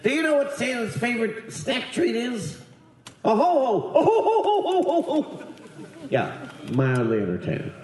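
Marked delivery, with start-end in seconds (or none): 0.0-5.5 high Santa voice rolling into ho-ho-hos; Brooks. Retold or imitated. Santa telling children a joke, high jolly voice with booming laugh. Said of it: "that's how he talked".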